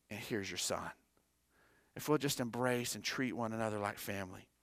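quiet, solemn reading of scripture to the congregation; hushed preaching. Acted. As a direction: bring it down low and intimate.

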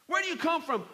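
high-pitched stern question; harsh questioning. Acted. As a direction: put a sharp demand to strangers. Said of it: "he spoke harshly to them".

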